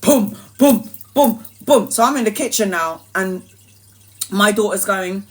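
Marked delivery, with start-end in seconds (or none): 0.0-1.9 louder thumping heartbeat; mimicked pounding heart; heartbeat imitation. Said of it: "see my chest going".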